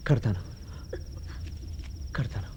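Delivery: terrified soft mumble of కడతాను; fearful murmur. Acted. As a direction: give in under threat. Frightened man forced to marry.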